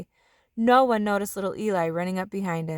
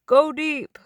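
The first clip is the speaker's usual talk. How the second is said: high, loud call across the yard; dad calling a long throw; 7 semitones higher, 6 dB louder than usual. A narrator's reading. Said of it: "called Archie".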